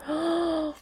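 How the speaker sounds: high gasp of delight; sharp excited gasp. Real, not acted. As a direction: gasp at the card.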